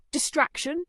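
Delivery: high, amused questioning; knowing query. Acted. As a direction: echo it with a knowing smile. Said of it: "a knowing smile playing on his lips".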